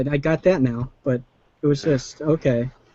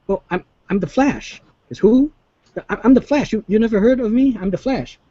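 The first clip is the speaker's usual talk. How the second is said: lightly voiced Flash, pitched up; retold hero banter; 7 semitones higher than usual. Flash introducing himself to Supergirl, mock proud. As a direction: act out the Flash bragging.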